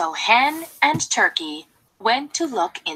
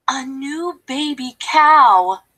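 ordinary delivery, nothing marked

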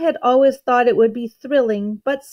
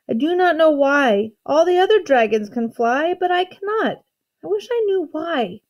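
higher, mildly tearful dragon; understated tearful lament; sobbing; 6 semitones higher than usual. A narrator's reading. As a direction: let a little sob rise into the words. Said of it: "he sobbed".

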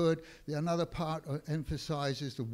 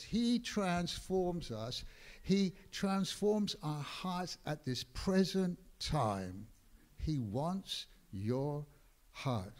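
slow, weighty, each word placed; slow emphasis; slower than usual. Acted. Preacher to congregation, slow weighty emphasis. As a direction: let it land slowly.

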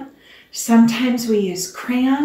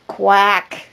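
duck quack; 5 dB louder than usual. Acted. Duck surprises.

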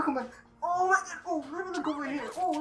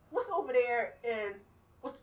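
ordinary delivery

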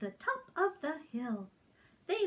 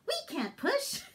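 high chicken voice; 9 semitones higher than usual. Acted.

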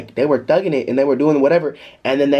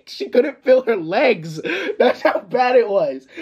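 high-pitched, drawn-out amused disbelief; laughing; 9 semitones higher, slower than usual. Real, not acted. Telling viewers how bad it was, laughing. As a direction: laugh through the slow words. Laughing at his own story.

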